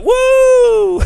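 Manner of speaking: high, loud whooping cheer; mock crowd cheer. Imitating the crowd cheering an overtake, loud whoop. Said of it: "the crowd are like".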